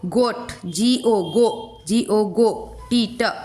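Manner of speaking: letters stretched out one by one, then blended into गो; sounding out like a phonics teacher; slow phonics blending. Shown as a demonstration. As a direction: build गोट slowly from जी ओ. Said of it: "ठीक है".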